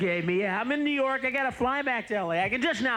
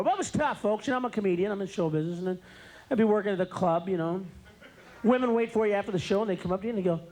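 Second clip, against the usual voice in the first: usual speaking voice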